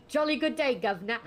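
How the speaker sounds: loud, high hammed-up British accent; posh British greeting with a hammed-up grin. Shown as a demonstration. Showing off the British accent for a class skit, loud. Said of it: "put a British accent".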